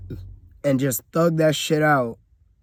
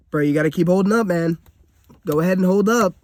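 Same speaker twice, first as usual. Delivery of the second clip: higher, louder mock of a nagging relative; mocking family quote; 6 semitones higher, 6 dB louder, faster than usual. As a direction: throw their words back in a higher, louder sneering voice. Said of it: "repeatedly keep saying".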